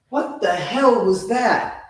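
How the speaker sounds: high, slow, incredulous demand; startled disbelief. Retold or imitated. Producer walking in on the plea, stunned. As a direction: draw it out in mock astonishment.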